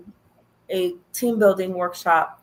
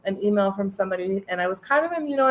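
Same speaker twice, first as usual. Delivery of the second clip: usual speaking voice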